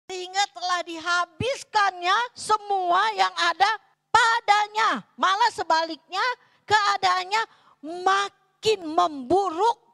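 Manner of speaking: loud, high proclamation, dragged out slowly; preaching at full force. Acted. Preacher proclaims the verse to the congregation, loud and high.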